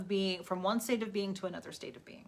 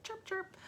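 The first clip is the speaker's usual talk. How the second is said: high, soft chirping; baby bird chirp; 13 semitones higher, 8 dB quieter than usual. Retold or imitated. Newborn phoenix rising from the ashes, high soft chirps. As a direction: peep it like a tiny chick.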